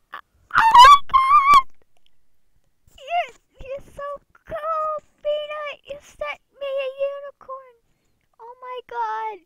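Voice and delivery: very high, slow, screaming joy; ecstatic shriek. Real, not acted. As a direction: shriek like you won big. Unicorn gift reveal.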